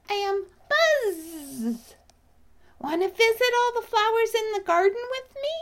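high, loud, eager bee voice; Buzz. Acted. Bee introduces himself, invites cub.

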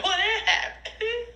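high laughing question; bewildered laugh. Real, not acted. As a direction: giggle it out.

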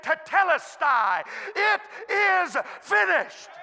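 slow, high, loud proclamation; proclaiming. Acted. Preacher voicing Jesus' last words, slow loud proclamation. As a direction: ring out each word slowly like a final declaration. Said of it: "a one-word announcement".

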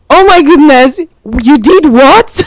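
high, loud, disbelieving exclamation; shocked disbelief. Retold or imitated. Mom hearing her eleven-year-old carried a girl's bag, loud shocked disbelief. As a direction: gasp it out as if you can't believe your ears.

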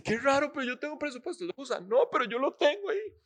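a hint of a surprised churchgoer; acted congregant. Pastor voicing a churchgoer who owns an unused budget.

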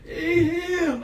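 put-on high, whiny kid tattling; imitating a child. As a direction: point at him like a tattling kid. Child voiced accusing.

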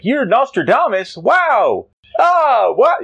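high amazed gasp of disbelief; excited Jimmy. Acted. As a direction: squeal it like a starstruck kid. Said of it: "And he's like".

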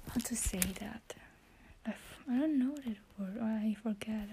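soft whispered talk to her phone; whispering. Real, not acted. Whispering her English practice into the phone. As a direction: keep it to a whisper throughout.

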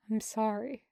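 soft. A narrator's reading. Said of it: "voice soft".

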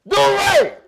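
high, loud mimicked holler; calling out. Acting out the remembered movie call, a high shout. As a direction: shout the name out like the movie character. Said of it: "what was his name he was calling".

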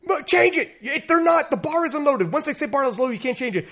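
high, urgent mock yell; retold yelling. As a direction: re-enact frantic pleading at the livestream. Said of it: "I'm yelling at the stream".

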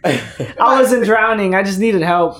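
high, loud, drawn-out joke denial; laughing mock excuse. Teasing a friend's drinking denial, laughing mock excuse. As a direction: protest through laughter.

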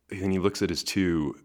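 ordinary delivery

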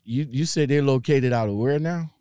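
ordinary delivery

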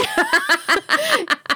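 a bright burst of high laughter. Real, not acted. Host laughing at her own Papa Roach joke, high laugh.

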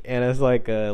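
ordinary delivery

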